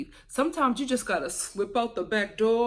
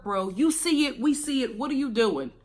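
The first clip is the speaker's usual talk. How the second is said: normal talking voice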